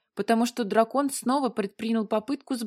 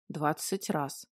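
low, hushed seething; contained rage; 6 semitones lower, 8 dB quieter, slower than usual. A narrator's reading. Dragon answering the heroine's reproach, low contained rage. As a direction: say it low and quiet, holding rage in. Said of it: "краснея от ярости, проговорил дракон".

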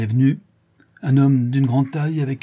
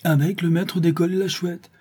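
higher, echoing the words in shock; a stunned echo of the news; stunned disbelief; 7 semitones higher than usual. Acted. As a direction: repeat it as if you can't believe it. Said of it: "stupéfait".

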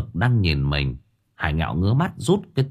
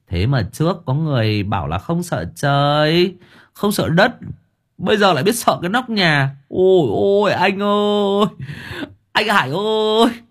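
much higher, sing-song mockery; mocking younger brother; 9 semitones higher than usual. A narrator's reading. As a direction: needle him, fake pleading. Long mocking. (giọng cao, chế giễu)

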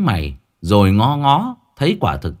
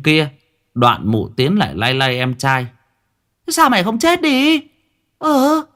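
high, sharp scolding; 8 semitones higher than usual. Acted. Liên scolds her brother for not being dead, high and sharp. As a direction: tell off the brother who isn't dead.

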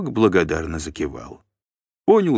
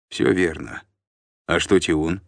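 usual speaking voice

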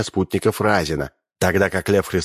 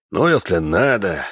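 ordinary delivery, nothing marked

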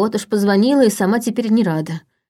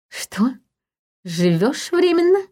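shocked, incredulous question; astonished; 6 semitones higher, slower than usual. Acted. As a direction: pitch leaps up in disbelief. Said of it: "От изумления у блондинки".

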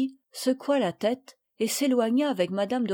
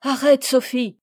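louder, urgent command; crying out; 3 semitones higher, 7 dB louder than usual. A narrator's reading. Camille grabs Sophie's arm, crying out. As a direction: cry out to stop her. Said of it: "s'écria Camille".

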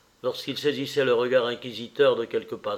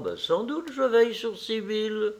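a lightly voiced fussy mother, higher; the mother lecturing her son, a touch higher; mother's voice; 9 semitones higher than usual. The mother answers. (la mère qui sermonne son fils, voix un peu plus aiguë)

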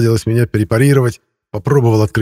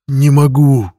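higher, louder strained cry; anguished groan; 5 semitones higher, 5 dB louder, slower than usual. A narrator's reading. Laslo cries out that he can't help, anguished. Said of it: "простонал Ласло".

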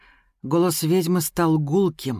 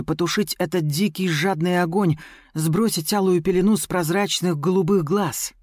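usual speaking voice

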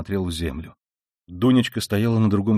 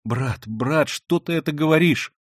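desperate cry; 7 semitones higher than usual. A narrator's reading. Sister crying out in despair at her brother. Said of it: "в отчаянии вскричала Дуня".

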